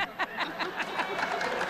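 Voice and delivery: breathy airy laugh, high; own laugh. Real, not acted. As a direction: laugh at your own joke. Laughs at own soda joke.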